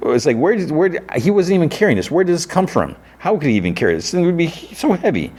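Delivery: high, baffled disbelief; incredulous. Real, not acted. Vlogger puzzling over the giant rifle case, high and incredulous. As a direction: ask it as if it makes no sense. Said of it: "viewer baffled by the case".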